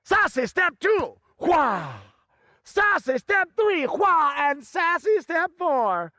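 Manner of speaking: high, slow sassy count-off; hammed-up sassy stage announcement. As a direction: call each step with stretched, showy sass. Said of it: "what I like to call the sassy way".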